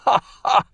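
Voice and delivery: high, breathy ha-ha of relief; relieved laugh. A narrator's reading. Herapath laughs with relief at the doctor's advice.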